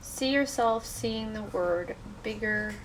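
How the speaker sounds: soft, unhurried meditation voice; guided meditation. Real, not acted. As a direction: gently guide listeners into letting go.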